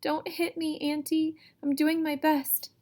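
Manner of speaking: scared sister begging a cruel aunt; frightened plea. Acted. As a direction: beg her not to strike again. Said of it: "a low cry".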